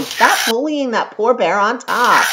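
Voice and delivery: ordinary talk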